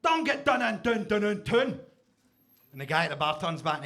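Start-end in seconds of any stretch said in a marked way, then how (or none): 0.0-1.8 put-on high, loud drunk yell; pub shouter. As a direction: bellow across the pub. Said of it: "He goes".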